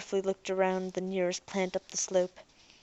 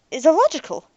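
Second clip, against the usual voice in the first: protesting; 8 semitones higher, 8 dB louder than usual. Acted. Robot protesting.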